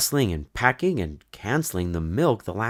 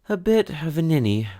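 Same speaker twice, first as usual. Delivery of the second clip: ordinary delivery, nothing marked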